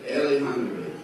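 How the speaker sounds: said flatly and seriously, falling at the end; deadpan, totally in earnest; serious statement. Retold or imitated. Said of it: "And he's not laughing".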